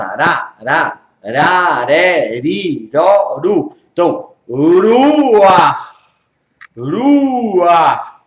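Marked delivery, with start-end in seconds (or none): 0.6-3.8 "Ra, ra, re, ri, ro, ru" with tapped r; R sound drill. Shown as a demonstration. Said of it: "É Ureish. Ureish é de barata". 4.5-8.2 "Rua" very drawn out, throaty final h; guttural ending of Rua. Shown as a demonstration. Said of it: "o C H dá o som do, da gutural".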